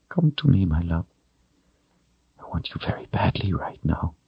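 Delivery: breathy lover's whisper; soft intimate whisper; seductive whisper. A narrator's reading. Denna seductive in bed.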